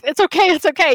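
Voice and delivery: high, loud retold fluster; flustered protest. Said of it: "I'm, like, freaking out".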